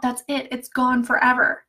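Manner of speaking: ordinary talk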